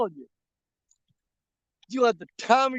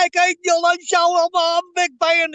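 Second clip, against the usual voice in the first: louder and higher, gushing at his idol; eager fan greeting; 9 semitones higher, 7 dB louder than usual. Retold or imitated. Walking up to Keith at CatCon, eager and loud. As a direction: bounce up to him like a starstruck fan. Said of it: "I walked up to Keith and said".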